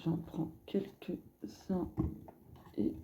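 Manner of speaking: hushed whisper while picking cards; breathed, almost silent. Real, not acted. Tarot reader murmuring to herself as she draws.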